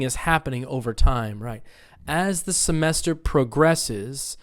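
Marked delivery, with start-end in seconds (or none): 2.1-4.4 slow model phrase, "progresses" as proGRESSes; second-syllable stress. Shown as a demonstration. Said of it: "verbs on the second".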